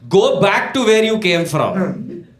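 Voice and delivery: slow, high mock yell; drawn-out hostile mock shout; yelling back. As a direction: yell it word by word like a heckling stranger. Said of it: "That guy yells back".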